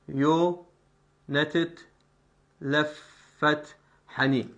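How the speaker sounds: slow, syllable by syllable; slow scansion reading. Shown as a demonstration. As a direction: lay out the metre deliberately. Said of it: "مثلًا والغريب عيونه تتلفت".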